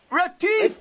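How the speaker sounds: high, exaggerated South African "Retief!"; South African accent. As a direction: belt the name with a South African twang. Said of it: "without doing it in a South African accent".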